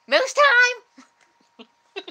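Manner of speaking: very slow, high laughing shout. Acted. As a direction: belt it out with laughter. Jokes about being Canadian.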